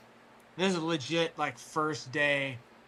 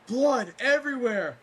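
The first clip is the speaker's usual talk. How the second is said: high, loud exclamation; acted alarm; 8 semitones higher, 5 dB louder than usual. Retelling the moment he pulls down his pants, high loud alarm. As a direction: imitate an alarmed outburst, pitched up. Acting out discovering the injury.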